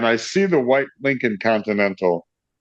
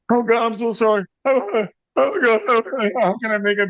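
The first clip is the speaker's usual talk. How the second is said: high, panicky retold pleading; frantic apology; 11 semitones higher than usual. Musician begging angry owner's pardon, frantic. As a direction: babble a flustered apology up high.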